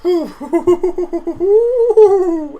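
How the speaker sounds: high breathy laugh after the rant; embarrassed laugh. Real, not acted. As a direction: laugh it off, a bit sheepish. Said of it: "I got a little emotional".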